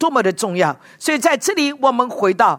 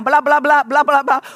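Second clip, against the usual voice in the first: put-on toddler babble; much faster than usual. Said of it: "他一直讲".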